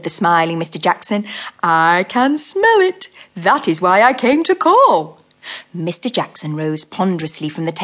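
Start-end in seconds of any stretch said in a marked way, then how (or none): 1.6-5.2 slow, fat, comfortable toad voice; fat-voiced toad. Mr Jackson makes himself at home, slow fat toad voice. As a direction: settle in like a contented fat toad.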